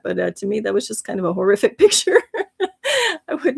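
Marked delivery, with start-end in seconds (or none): none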